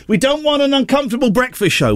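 high, insistent objecting boss; disapproving boss. Retold or imitated. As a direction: squeal out the boss's refusal. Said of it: "he's thinking".

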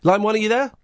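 line one called out loud and high; loud, high hail down the line; calling out. Real, not acted. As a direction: call out to the caller.

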